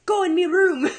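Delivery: high, loud mock-angry Irish-sounding toddler; mad child imitation. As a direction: order like a cross little girl. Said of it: "she'll be mad at me".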